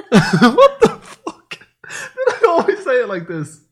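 loud, high, laughing disbelief; baffled and cracking up; incredulous laughter. Real, not acted. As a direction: shriek it through laughter. Shocked at own pronunciation, laughing.

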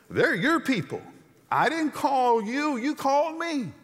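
high, loud pushback; indignant retort. Acted. Moses pushing back at God, indignant and loud. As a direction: throw it back at God, defiant. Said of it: "And Moses says".

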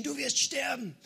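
high-pitched retold yell at normal volume; mock scream. Voice in the sick woman's head threatening her, mock scream. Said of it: "Die Stimme hat geschrien".

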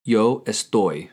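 slow, clear Spanish answer for learners; teacher modelling Yo estoy slowly; slow model answer. Shown as a demonstration. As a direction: spell it out slowly for the student. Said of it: "How do you say "I am" in Spanish".

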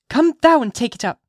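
put-on high, loud little girl's voice; defiant child. A narrator's reading. Child refusing her mother's order, high defiant little voice. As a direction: pipe up as a wilful child.

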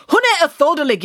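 high, loud angry yell; girl yelling. Acted. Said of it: "yelled the girl".

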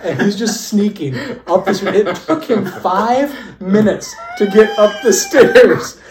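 barely getting the words out for laughing; storyteller loses it describing his friend sneaking up stairs; laughing hard. Real, not acted. Cracking up telling the story.

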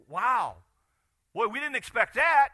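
high, loud mock astonishment; buddies amazed. The four buddies reacting to the healing, amazed. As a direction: gasp it out in wonder.

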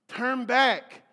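emphatic call. Acted. Preacher repeats key phrase.

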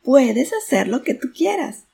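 high, loud echoed call; enthusiastic echo. A narrator's reading. Mountain echo answers the father's encouragement, high loud call. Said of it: "Y la voz respondió".

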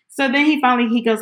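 usual speaking voice

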